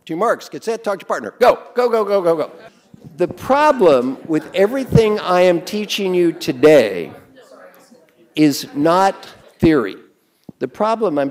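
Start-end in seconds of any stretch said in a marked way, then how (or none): 1.4-2.5 loud urging high go. Real, not acted. Teacher starting the partner exercise, loud and urgent. 3.2-10.0 slow, loud, deliberate emphasis; emphatic slow point. Real, not acted. Teacher stressing a key point, slow and loud.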